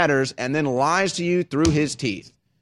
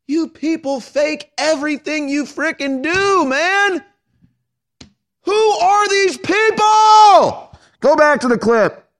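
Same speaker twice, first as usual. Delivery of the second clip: much higher, loud, slow outraged yell; exploding in disbelief at them; furious shout; 13 semitones higher, 6 dB louder, slower than usual. Real, not acted. Furious rant at the clip.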